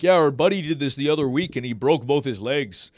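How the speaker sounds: breezy friend voice, lightly put on; casual hardcore friend, lightly mimicked; imitating the friends. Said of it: "that's how they sound".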